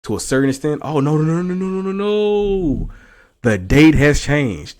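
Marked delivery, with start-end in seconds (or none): 0.8-2.9 loud, rising string of no's; shocked realization. Real, not acted. Realizing the date changed. 3.4-4.8 loud and slow; dramatic reveal. Real, not acted. Announcing the discovery.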